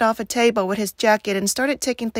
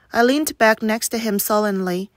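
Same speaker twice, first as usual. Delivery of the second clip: ordinary delivery, nothing marked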